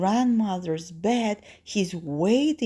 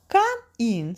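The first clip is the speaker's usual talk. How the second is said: higher, louder granny voice; lightly voiced granny, calling out; grandmother; 5 semitones higher, 5 dB louder than usual. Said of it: "says grandmother".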